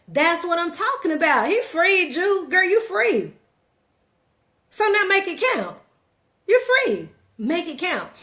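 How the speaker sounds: high-pitched, excited encouragement; jubilant cheering. Retold or imitated. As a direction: celebrate her freedom, voice riding high. Cheering friend freed from her job.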